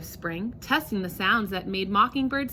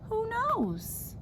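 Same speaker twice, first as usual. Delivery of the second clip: wondering question; 12 semitones higher, 5 dB quieter than usual. Acted. Wondering end of the story.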